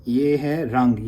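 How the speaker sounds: ordinary delivery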